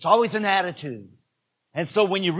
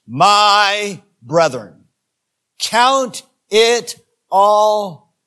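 drawn out, very slow and loud; slow loud suspense before a shocking line; slow dramatic buildup; 3 semitones higher, 7 dB louder, much slower than usual. Shown as a demonstration. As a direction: stretch each word to build suspense. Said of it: "make a statement that's going to shock you".

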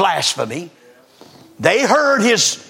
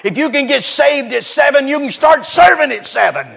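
fiery preaching; 7 semitones higher than usual. Acted. Preacher urging children and young people to serve, fiery. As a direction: drive it home to the congregation. Preacher exhorts at full force.

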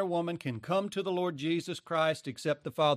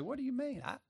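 mock indignant surprise; 8 semitones higher, 6 dB quieter, faster than usual. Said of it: "they say".

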